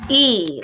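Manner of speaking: long E sound, said out clearly. Shown as a demonstration. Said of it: "you would hear".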